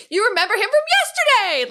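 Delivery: very high, loud, fluctuating shriek; excited scream. Retold or imitated. Retelling Chris screaming through tears at Disney, excited scream. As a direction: scream it in tearful, joyful disbelief. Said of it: "and we're screaming".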